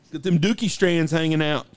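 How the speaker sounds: ordinary delivery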